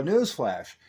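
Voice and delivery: usual speaking voice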